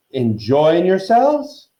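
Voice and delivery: slow, high, loud inquiry; genial ghost host. A narrator's reading. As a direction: greet guests grandly, drawing it out. Ghost host greets guests.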